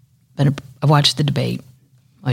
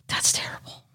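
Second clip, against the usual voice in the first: whisper; 9 dB quieter than usual. Real, not acted. Sad news about missing dolphin.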